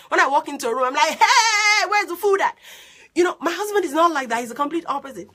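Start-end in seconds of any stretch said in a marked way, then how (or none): 1.2-2.6 loud, high, whooping greeting; excited entrance. Retold or imitated. Excitable wife walking into a room, loud and excited. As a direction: burst in hollering, high and big. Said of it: "I carry excitement with me".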